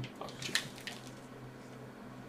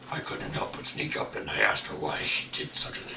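a lightly lowered voice reading a diary; deepest voice; 6 semitones lower than usual. Pretend. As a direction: read the notes in your deepest voice. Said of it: "deepest voice you can".

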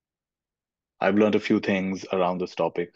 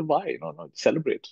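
mock protest; 6 semitones higher than usual. Most people answering.

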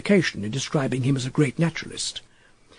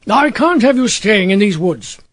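firm landowner laying down the law; mildly stern command; authoritative; 5 semitones higher, 4 dB louder than usual. A narrator's reading. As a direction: declare it with authority, a little louder. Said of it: "he declared authoritatively".

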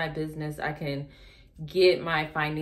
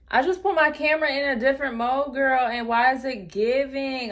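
higher, louder, thrilled about the camera; real delight, higher and louder; excited; 7 semitones higher, 7 dB louder than usual. Real, not acted. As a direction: gush over the new mode. Delighted by new camera mode.

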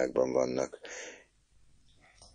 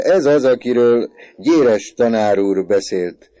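slow, loud, high announcement; hearty recognition; 7 semitones higher, 12 dB louder, slower than usual. A narrator's reading. Father recognising the boy the teacher praised, hearty recognition. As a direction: proclaim it slowly at full voice.

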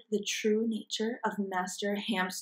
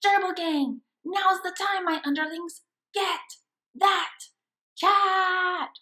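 much higher, shouted command; rally the gang to attack; villain battle cry; 9 semitones higher, 5 dB louder than usual. Acted.

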